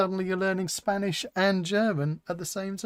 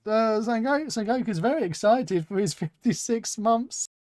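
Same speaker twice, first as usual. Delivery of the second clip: ordinary talk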